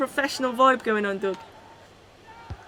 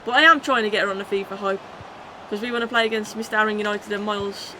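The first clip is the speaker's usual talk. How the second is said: ordinary talk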